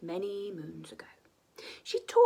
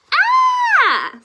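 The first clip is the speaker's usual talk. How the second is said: very high, loud exclamation of delight; delighted high cry; 18 dB louder than usual. Acted. Teacher dragon delighted at Zog's first princess. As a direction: burst out with joy. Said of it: "said Madam Dragon".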